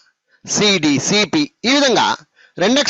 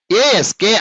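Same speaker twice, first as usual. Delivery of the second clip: ASK run together as one unit; joined letters; much slower than usual. Shown as a demonstration. Teacher modelling for pupils how to say it, ASK joined without breaks. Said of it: "అనాలి".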